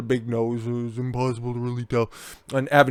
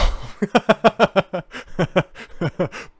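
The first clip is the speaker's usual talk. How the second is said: laughing; 7 semitones higher, 4 dB louder than usual. Real, not acted. Laughs at the quote.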